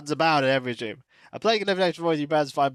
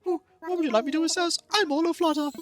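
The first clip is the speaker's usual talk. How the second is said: high, excited curator voice; high-pitched, all-aflutter character voice; fluttery museum host; faster than usual. Acted. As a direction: gush like an eager museum keeper.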